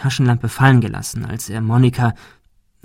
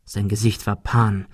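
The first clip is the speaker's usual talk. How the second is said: ordinary delivery, nothing marked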